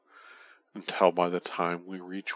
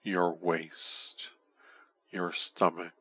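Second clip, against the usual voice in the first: ordinary delivery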